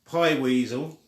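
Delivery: ordinary delivery, nothing marked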